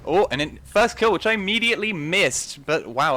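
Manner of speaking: sudden high, loud burst of excitement; excited. Real, not acted. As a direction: yell it out as the kill lands. First kill while casting live.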